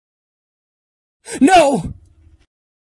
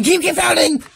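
shout; 7 semitones higher, faster than usual. Acted. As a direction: shout it out urgently. Urging the driver on, repeated.

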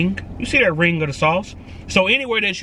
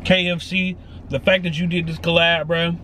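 straw at the lips; speaking while sipping drink; talking around straw; 5 semitones lower than usual. Real, not acted. Seen 1: cup held up, straw tip at lips while talking.

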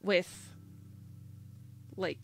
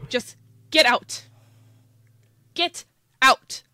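screamed, much higher and louder; screaming; 6 semitones higher, 12 dB louder than usual. Acted. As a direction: throw him out at full volume. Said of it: "the server once again screams".

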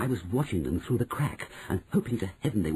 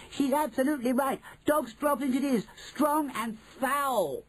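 crying out. Acted. Another witch confirms the smell, high cry. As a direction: declare it high with disgusted relish. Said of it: "cried another voice".

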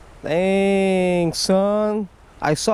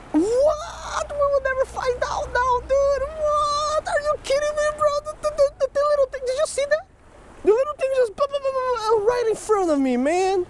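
very high, stammering shock at the lost fish; disbelieving outburst. Real, not acted. Angler watches a little fish dart off, high stunned outcry. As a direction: squeal in disbelief, tripping over the words. Fish escapes right in front.

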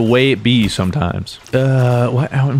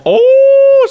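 very high, loud drawn-out whoop; loud exclamation; 9 dB louder than usual. Real, not acted. Gamer crowing over an opponent, loud exclamation. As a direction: crow it out at full pitch. Player reacts to a kill.

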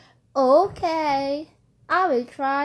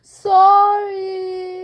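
expressive sorry; 6 semitones higher, 8 dB louder than usual. Shown as a demonstration. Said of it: "Do this in present".

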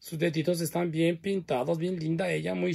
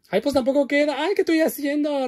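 higher, louder flustered cry over the shoe; flustered dismay; 10 semitones higher, 6 dB louder than usual. Real, not acted. Host fumbling the doll stand, flustered and higher. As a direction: fluster at her own clumsiness.

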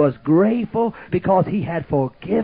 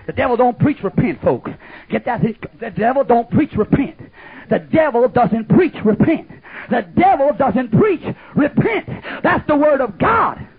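full-force preaching; 4 semitones higher, 7 dB louder than usual. Acted. Repeated preaching refrain.

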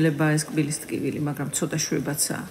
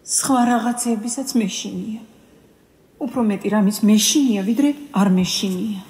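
higher, louder, slowed confession of fear; mounting fear; 5 semitones higher, 5 dB louder than usual. Acted. Woman listing what she is afraid of, fear mounting. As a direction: let the dread build, raised and insistent. Confessing that her fears keep multiplying.

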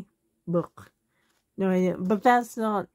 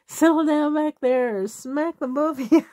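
imitating a fed-up mom in a higher pitch; retold parental threat, voice raised in pitch; mock scolding; 7 semitones higher, 3 dB louder than usual. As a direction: scold the back seat, playfully acted. Retold mom scolding kids in car.

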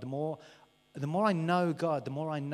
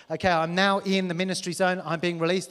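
mock hype; 3 semitones higher, 9 dB louder than usual. Mock hyped conference goer.

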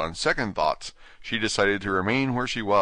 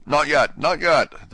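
high, loud and hurried; hasty interruption; 8 semitones higher, 7 dB louder, slower than usual. Acted. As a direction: cut in before the king can finish. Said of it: "the rabbit hastily interrupted".

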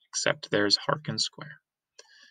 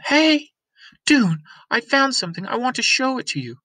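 put-on high, bright call of a young girl, a bit fast; a girl calling her friend over, excited; eager girl voice; 12 semitones higher, 5 dB louder than usual.

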